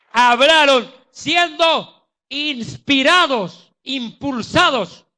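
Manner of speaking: loud, slow climax on "inspirados, impulsados"; preaching shout. Real, not acted. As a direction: build to a fervent preaching cry. (clímax de predicador, fuerte y lento)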